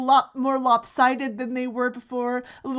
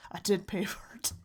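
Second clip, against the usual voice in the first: whispering; 7 dB quieter than usual. Real, not acted. Whispered aside.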